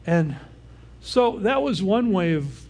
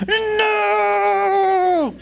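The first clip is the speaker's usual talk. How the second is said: imitated shrieked no, much higher and louder; toddler refusing; 8 dB louder than usual. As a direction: shriek it like a defiant two-year-old. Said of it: "And she goes".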